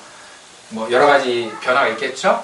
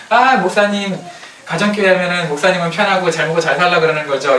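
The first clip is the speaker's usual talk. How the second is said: raised, louder voice mimicking a grumbling churchgoer; imitated complaint; 5 semitones higher, 4 dB louder than usual. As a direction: protest to the pastor in a mock accusing tone. Mimics congregants' complaint to pastor.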